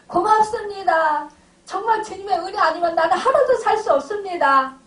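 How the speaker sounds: 더 높고 더 크게, 천천히 벅차게 감사를 고백; 감격에 겨운 목소리로 외치듯이; 감사에 북받친 고백. Retold or imitated. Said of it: "감사해서 우는 거예요". (감격해서 높고 크게 외치는 고백)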